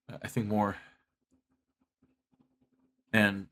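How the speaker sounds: low, soft-spoken and defeated; quiet despair. Real, not acted. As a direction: confess it quietly, like the dream is gone. Quiet confession of lost dream.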